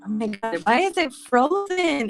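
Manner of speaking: ordinary talk, nothing marked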